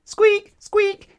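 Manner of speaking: very high, loud squeak; shrill mouse squeaking loudly; roaring mice. Acted. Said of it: "they'd roar at each other".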